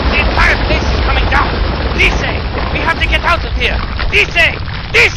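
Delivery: yelling high and loud over collapse; frantic shout. Acted. Ally screaming for a friend as the place falls, frantic yelling. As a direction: scream the name, panicked, to be heard. Fortress collapsing, calling companion.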